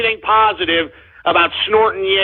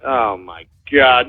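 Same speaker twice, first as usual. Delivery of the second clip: normal talking voice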